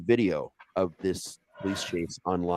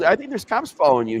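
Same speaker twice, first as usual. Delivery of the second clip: pitched way up, dazed and innocent; high stoned voice; 8 semitones higher, 5 dB louder than usual. Retold or imitated. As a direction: ask it in a high, spaced-out voice. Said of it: "I was".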